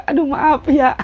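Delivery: high, laughing apology; maaf sambil tertawa. Real, not acted. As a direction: apologise through giggles. (minta maaf sambil cekikikan)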